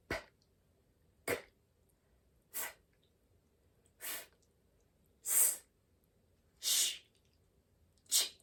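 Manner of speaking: breathy unvoiced consonants, just air; teacher pushes out each voiceless sound as pure air. Shown as a demonstration. Teacher demonstrating voiceless consonants, only air from the mouth. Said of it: "All of them are very quiet".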